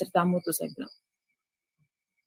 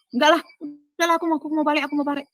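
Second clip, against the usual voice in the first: flustered refusal; 8 semitones higher, faster than usual. Retold or imitated. Flustered refusal, wanting to leave.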